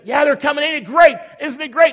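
high, delighted exclaiming; excited. Acted. Mary answers Martha about guests coming, excited. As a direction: play thrilled about company coming.

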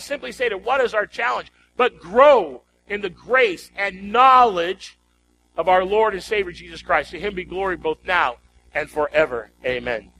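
ordinary talk, nothing marked

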